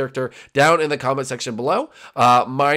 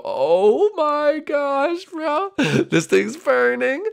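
high, drawn-out cry of disbelief; dramatic exclamation; 13 semitones higher, much slower than usual. Real, not acted. Gamer reacting to the house burning, high dramatic exclamation. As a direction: stretch it out in high-pitched shock.

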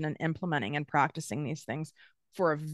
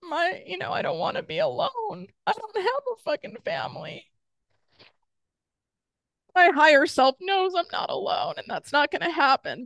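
much higher, scared inner voice; voice of fear; 11 semitones higher than usual. Retold or imitated. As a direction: let the fear talk, pitched way up. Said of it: "So the fear is saying".

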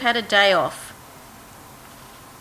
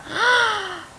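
sharp high gasp of alarm; a sudden startled intake of breath; acted gasp; 10 semitones higher than usual. Axe head falls in water.

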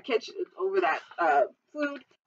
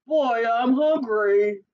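loud, drawn-out giant bellow; booming giant; 6 dB louder, much slower than usual. Acted. Hungry giant striding into the kitchen, booming. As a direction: announce it big and slow.

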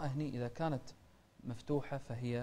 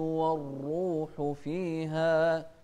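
slow chanted tajweed recitation; slow melodic Quran chanting; 3 semitones higher, 4 dB louder, slower than usual. Shown as a demonstration. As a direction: recite slowly, drawing out the vowels. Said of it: "نوضح الهمزة مع كسرها".

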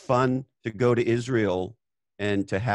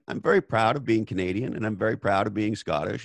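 ordinary talk, nothing marked

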